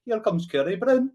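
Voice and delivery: high, loud mock Edinburgh accent; Edinburgh impression, loud and high. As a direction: imitate a mate's Edinburgh accent, pitched right up. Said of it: "he had an Edinburgh accent".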